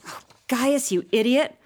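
put-on an older sister losing patience with her brother; exasperated scolding. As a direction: snap at him, fed up.

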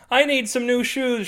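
high voiced shout at the store. A narrator's reading. Big Feet Girl shouting into the shoe store, high call. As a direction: call out for shoes. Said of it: "she shouts".

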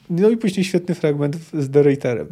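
usual speaking voice

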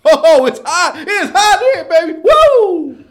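high, very loud wrestler hype; Ric Flair. Pretend. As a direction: whoop it up at full volume, ending on a soaring "woo". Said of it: "I'm Ric Flair".

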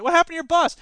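a high curious mock question; lightly voiced passer-by; mock bystander. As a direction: play a bystander asking, amused. Acted nun dialogue.

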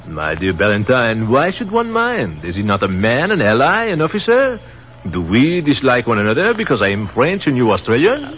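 put-on French captain chiding the Australian, in a French accent. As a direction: gently scold him, in a French accent.

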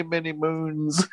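usual speaking voice

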